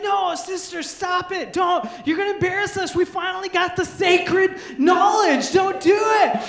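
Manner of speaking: high, loud pleading shout; panicked kids begging. Retold or imitated. Little kids begging the older sister not to go to Mike's, shouting. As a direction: beg her not to embarrass you.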